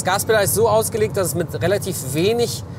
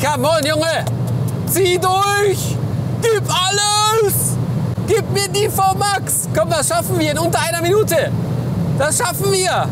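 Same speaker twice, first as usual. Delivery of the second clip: sideline yelling; 9 semitones higher, 3 dB louder than usual. Real, not acted. Cheering the car to top speed.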